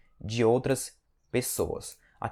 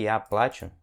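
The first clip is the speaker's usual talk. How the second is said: плачу as PLA-chu, falling off after A; stress on the A. Shown as a demonstration. Teacher gives the form meaning "I cry", stressed A in плачу. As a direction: stress the first syllable of плачу. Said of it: "Aí sim significa".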